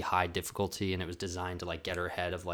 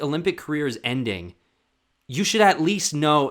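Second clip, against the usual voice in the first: normal talking voice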